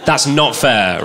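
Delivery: ordinary talk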